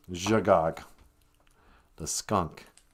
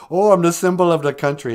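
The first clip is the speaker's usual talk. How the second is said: mocking eagle; 8 semitones higher, 9 dB louder than usual. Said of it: "everyone thinks he's the big".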